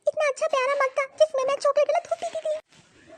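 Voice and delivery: put-on high girl's whine; a little girl sulking over her mug; whining child. Child mourns her broken mug. (बच्ची की ऊँची रुआँसी आवाज़)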